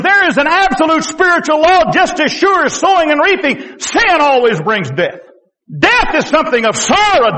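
high, pleading pulpit cry; impassioned preaching. Real, not acted. Preacher warning his friends about sin and death, impassioned preaching. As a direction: press the warning on the congregation with rising and falling cries.

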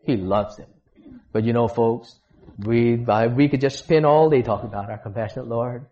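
ordinary talk

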